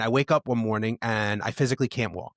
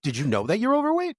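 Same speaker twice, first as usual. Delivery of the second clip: mock doctor; 9 semitones higher than usual. Mocking a doctor's obvious remark.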